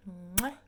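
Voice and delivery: loud smacking air kiss; kiss sound. Real, not acted. Blowing viewers a kiss, loud smack.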